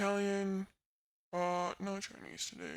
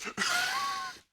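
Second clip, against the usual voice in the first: high laugh; 5 dB louder than usual. Real, not acted. Laughing with chat.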